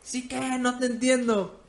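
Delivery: half-volume replay of his high outburst; retold yell. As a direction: imitate a confused bandmate yelling back. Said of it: "me gritó".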